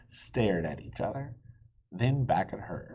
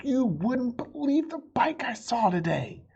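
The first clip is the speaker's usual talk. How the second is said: put-on high, eager child's voice; excited exclaim; 3 dB louder than usual. Amelia Bedelia exclaiming to her parents about the bike. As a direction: gush about the bike, much higher.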